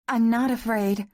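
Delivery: high, strained denial; voice catching. A narrator's reading. Woman denies fear to her lover, voice catching. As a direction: deny it with a catch in the throat. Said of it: "her voice caught in her throat".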